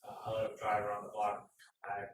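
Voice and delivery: ordinary delivery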